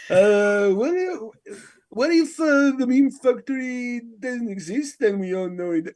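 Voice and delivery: slightly halting put-on host voice; Artie impression. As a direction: imitate someone posing a big question. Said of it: "give us your Artie impression".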